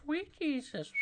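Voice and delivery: very high voice with whistled S sounds; Herbert. Acted. Streamer showing a hard cartoon impression, high whistling Herbert voice. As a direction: whistle through the teeth on each S. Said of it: "Herbert's so hard to do the whistle".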